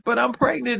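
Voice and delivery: high, wobbly tearful voice, imitating herself; retold crying. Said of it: "I'm crying on the phone".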